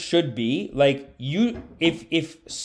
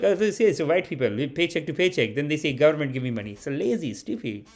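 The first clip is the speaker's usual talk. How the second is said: put-on Patel uncle accent; 4 dB quieter than usual. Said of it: "they'll still even rip".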